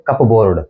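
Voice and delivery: कपबोर्ड with P sounded, cup-board; common mispronunciation. Shown as a demonstration. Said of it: "इसका हम उच्चारण करते हैं".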